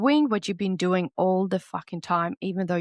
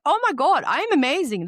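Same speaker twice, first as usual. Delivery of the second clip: gushing reply; 7 semitones higher, 5 dB louder than usual. Retold or imitated. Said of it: "It's like".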